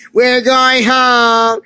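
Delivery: drawn-out triumphant yell; excited holler. Acted. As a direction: holler it out slowly, stretching the words. Train riders yell to be let out.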